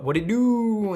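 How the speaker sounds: high, loud, whooping hello; hammed-up triumphant greeting; showy greeting. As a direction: holler it as a big cocky entrance. Hero's greeting on returning home.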